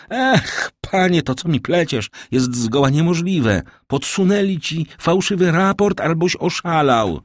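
high-pitched, incredulous scolding; indignant disbelief. A narrator's reading. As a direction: dismiss it as nonsense, pitched well up. King disbelieves the minister's news.

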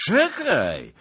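put-on loud, high drunken wait; drunken bark. A narrator's reading. Drunk grabs lieutenant's coat, loudly orders him wait.

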